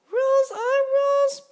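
very high, loud squeaky little mosquito; a tiny self-important ferryman insisting on rules. A narrator's reading. Mosquito ferryman lecturing Grasshopper, very high and loud.

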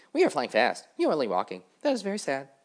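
loud, high, boastful dragonflies; brash dragonfly voice. Acted. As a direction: call out high and loud, showing off.

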